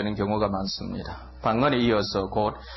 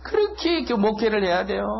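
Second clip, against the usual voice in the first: ordinary delivery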